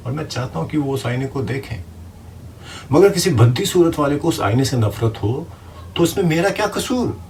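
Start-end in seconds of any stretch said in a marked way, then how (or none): 2.9-7.2 high, loud, mounting rhetorical protest; indignant outburst. Acted. Writer defending his stories to critics, outraged and loud. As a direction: build to an outraged shout.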